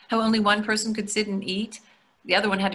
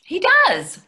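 high, louder playful insisting; emphatic insistence; 11 semitones higher, 6 dB louder than usual. Acted. Wife teasing husband about the admiring waiter, high emphatic insistence.

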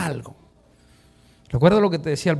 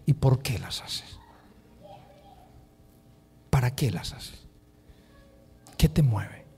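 low, much softer questions; soft searching question; 5 semitones lower, 9 dB quieter than usual. Acted. Preacher asks the congregation to question themselves, softly. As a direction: ask it gently, inward. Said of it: "Yo quiero que tú te preguntes hoy".

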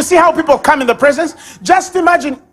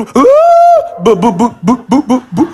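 mock wailing; 3 dB louder, slower than usual. A person with a bad report, mock wailing. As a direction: ham up a crying fit.

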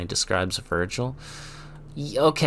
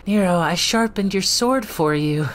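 put-on high sweet girlfriend voice; Kyrie voice; 9 semitones higher, 3 dB louder than usual. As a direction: gently call him to dinner. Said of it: "Kyrie speaking in skit".

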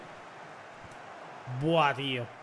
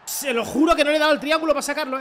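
excited protest; 6 semitones higher, 5 dB louder, faster than usual. Real, not acted. Protesting to the audience.

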